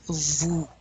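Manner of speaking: "Vous" as a long voo, S silent; rounded lips. Shown as a demonstration. Teacher shows the OU sound, rounded "Vous". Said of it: "You really want to round your lips".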